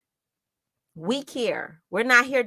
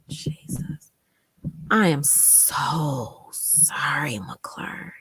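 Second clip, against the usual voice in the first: very slow, whispery, low apology; overcome, slowly breathing out sympathy; heartfelt sorrow; 4 semitones lower, much slower than usual. Real, not acted. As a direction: stretch SO and let it fade to a whisper. Overcome by survivor's account, consoling.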